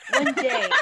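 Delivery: high false start, breaking into laughter; attempted narrator voice, much too high. Shown as a demonstration. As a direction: narrator opening that cracks up laughing. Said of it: "I have to do my narrator voice".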